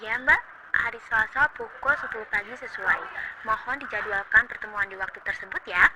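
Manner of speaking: usual speaking voice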